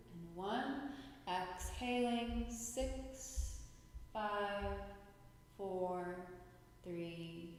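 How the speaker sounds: slow, measured counting with long pauses; unhurried yoga-teacher count, words stretched and spaced out; guided breathing. Shown as a demonstration. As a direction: lead them calmly through each drawn-out breath. Leads counted breathing exercise.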